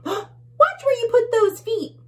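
high, loud bug warning; tiny bug. Acted. Tiny bug warning the bully, high and loud. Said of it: "says one of the tiny bugs".

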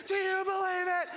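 loud, very high rousing cry; shouted challenge. Retold or imitated. Walker pressing the man to commit, shouting. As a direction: shout it like a showman working a crowd.

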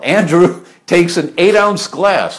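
amused disbelief, slow and laughing. Real, not acted. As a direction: chuckle in disbelief as you say it. Laughing about friend's huge dose.

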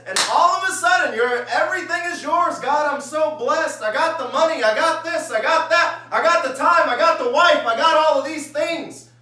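high, loud burst of overjoyed boasting; mock elation. Preacher acting out a newly blessed believer, exultant shouting. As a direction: crow about every blessing at full volume.

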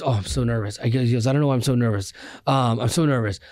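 normal talking voice

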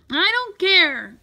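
loud, high, slow outburst; defiant cry. A narrator's reading. Boy refusing the warning about his pet, defiant cry. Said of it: "cried Louise".

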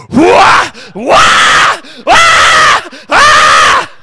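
high, loud war cries; cave woman yell. Retold or imitated. Wife beating at the snake, yelling wildly.

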